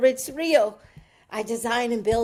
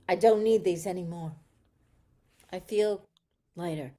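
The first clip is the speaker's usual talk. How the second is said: lower, softer grateful calm; 5 semitones lower, 7 dB quieter than usual. Acted. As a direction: speak gently, at peace. Calm gratitude after release.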